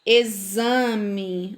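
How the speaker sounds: "Exame" drawn out as e-ZA-me; "Exame" with the x buzzed as z; X with Z sound. Shown as a demonstration. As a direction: model "Exame" with a clear z. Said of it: "tem o som da letra Z".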